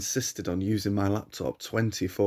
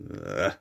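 low, soft groan of disgust; 5 dB quieter than usual. Acted. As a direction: groan at the smell. Narrator disgusted by the stench.

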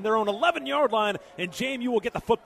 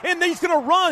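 excited; 7 semitones higher, 5 dB louder than usual. Real, not acted. Turnover called live.

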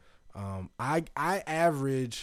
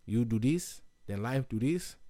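mock-sage Brazilian accent. Master likening jiu-jitsu to life, put-on Brazilian accent. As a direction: philosophize like a martial arts master with a Brazilian accent. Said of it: "Brazilian accent all morning".

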